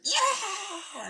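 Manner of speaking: high, loud mock cry of pain; pained yelp. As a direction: yelp as the hair is yanked. Luigi yelps as hair pulled.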